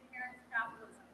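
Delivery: distant, echoing hall sound; speaking off-mic in an echoey room. Real, not acted. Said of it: "it's so echoey in here".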